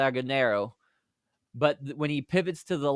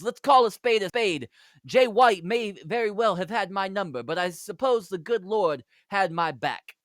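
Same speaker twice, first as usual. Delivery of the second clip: put-on Nick Aldis promo in a British accent, raised pitch; 6 semitones higher than usual. Narrator voices Nick Aldis's promo in a British accent. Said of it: "I don't like doing this accent".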